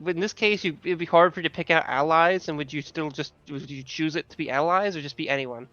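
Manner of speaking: ordinary talk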